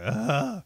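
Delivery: short, loud, high cry of pain; imitated distress cry. Imitating the guy on the bottom getting beaten, loud distress cry. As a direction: blurt a mock yelp of distress. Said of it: "making these sounds of distress".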